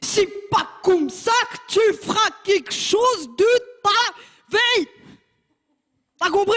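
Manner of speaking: high, slow, thundering warning; father's shout. Retold or imitated. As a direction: bellow it down at the kid. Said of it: "il m'a dit cette phrase". (cri du père, très aigu et lent)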